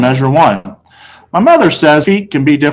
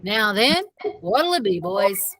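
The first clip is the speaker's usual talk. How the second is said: higher, soft, friendly salesman; shopkeeper voice; 7 semitones higher, 11 dB quieter, slower than usual. A narrator's reading. Shoe salesman greeting the boys, lightly voiced. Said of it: "Mister Berman asked".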